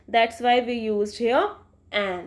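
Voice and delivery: slow, deliberate rule; slow emphasis. Shown as a demonstration. Teacher explaining the article to students, slow. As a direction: lay it out word by word. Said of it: "rule spoken for students".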